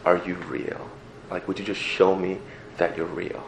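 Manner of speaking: softly pleading prayer, barely voiced; quiet prayer. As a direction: pray it under your breath.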